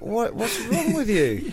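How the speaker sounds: high, slow incredulous question; mock baffled high-pitched retort; exasperated reply. Host answering Bing's whining, high slow disbelief. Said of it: "It's like".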